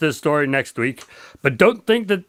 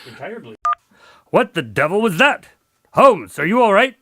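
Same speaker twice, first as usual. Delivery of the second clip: usual speaking voice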